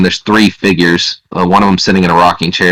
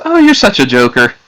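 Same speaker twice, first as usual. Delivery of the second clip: higher, teasing woman's voice; amused woman; 7 semitones higher, 3 dB louder than usual. Acted. Woman thinks the visitor is joking, high amused voice. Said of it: "laughs a little bit".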